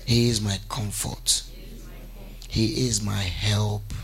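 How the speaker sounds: low, slow, measured affirmation; led declaration. Real, not acted. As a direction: intone each phrase for the congregation to repeat. Leads congregation in declarations.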